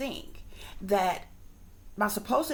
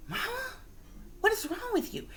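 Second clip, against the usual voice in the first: put-on concerned child; 13 semitones higher, 4 dB quieter, faster than usual. Child asks crying mother.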